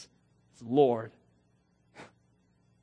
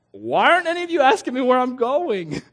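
exasperated Jesus; 6 semitones higher, 7 dB louder than usual. Acted.

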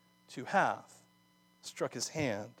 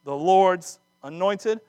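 indignant rebuke; 3 semitones higher, 5 dB louder, slower than usual. Acted. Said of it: "That's my paraphrase".

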